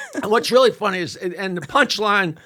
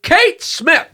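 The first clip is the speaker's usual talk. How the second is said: high, loud announcer voice; ring announcer; 9 semitones higher, 5 dB louder than usual. Retold or imitated. Comic voicing the announcer introducing [name], high and loud. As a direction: announce it to the whole arena. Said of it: "the announcer comes out and says".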